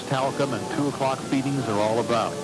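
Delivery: ordinary talk, nothing marked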